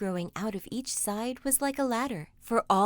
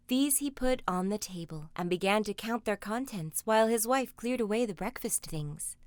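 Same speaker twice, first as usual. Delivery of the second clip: usual speaking voice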